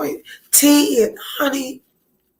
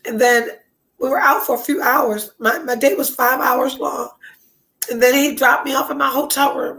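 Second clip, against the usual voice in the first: ordinary delivery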